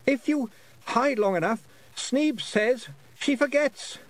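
put-on boy voice. A narrator's reading. Roland voiced in story.

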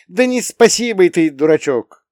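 high, loud, affectionate exclamation; fond scolding. A narrator's reading. Mother to her son who thanked her, fond scolding.

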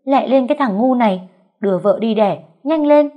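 yelling high and loud at a fool; frantic aunt bellowing orders; loud shout. A narrator's reading. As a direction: scream at him to hurry. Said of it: "dì hét lớn".

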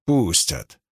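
low, cut-short certainty; curt retort. A narrator's reading. Narrator overruling Palych's warning, curt and firm. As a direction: shut the objection down in one low word. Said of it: "отрезал я".

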